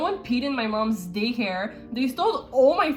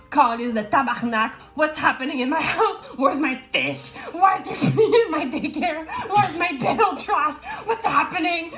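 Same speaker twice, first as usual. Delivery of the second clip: higher and louder, frantic questions; mother shouting at the trashed house, higher and louder; mock angry mom; 5 semitones higher, 4 dB louder than usual. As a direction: play the furious mom coming home to a wrecked house. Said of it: "She was like".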